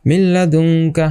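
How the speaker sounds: মিল্লাদুনকা slow, doubled l with no hum; merged without ghunnah. Shown as a demonstration. Teacher demonstrates merging, মিল্লাদুনকা slow with doubled l. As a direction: glide straight onto a double l in মিল্লাদুনকা. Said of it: "গুন্না ছাড়া just মিলিয়ে পড়তে হবে".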